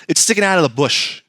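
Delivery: ordinary talk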